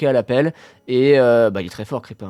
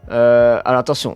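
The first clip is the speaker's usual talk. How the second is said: usual speaking voice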